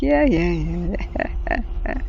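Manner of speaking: self-satisfied yeah fading into breathy laughter; a smug chick giggling. Pretend. Daughter bird gloating at her dad, laughing. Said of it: "She's like".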